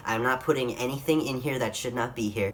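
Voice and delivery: normal talking voice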